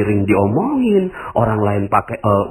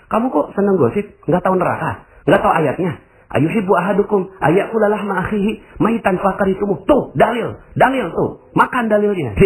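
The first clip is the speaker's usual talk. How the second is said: high, self-righteous rebuke; preachy scolding; 8 semitones higher than usual. Retold or imitated. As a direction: lecture them with hellfire. Orang yang menghakimi penggosip. (menegur dengan nada tinggi sok suci)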